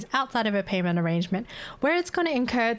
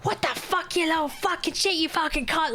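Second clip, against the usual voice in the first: a mocking take on a raging man swearing; mock angry customer; 8 semitones higher than usual. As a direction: throw his insults back in a sneering put-on voice. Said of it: "he's like".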